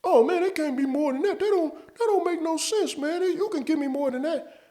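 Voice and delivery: high falsetto whiny coworker voice; mocking coworker. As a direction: squeak it out like the nagging buddy. Coworker haggling with clerk.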